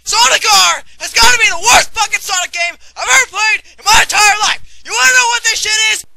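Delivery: loud furious ranting yell; screaming rant. Acted. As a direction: scream the verdict at full volume. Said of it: "prepare for a lot of screaming".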